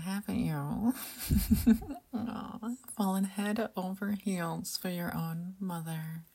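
sultry whisper. Acted. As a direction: whisper it close and teasing. Seductive whispered roleplay throughout.

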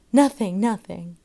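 loud jeering chant. A narrator's reading. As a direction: jeer it as a group. Children laugh and jeer.

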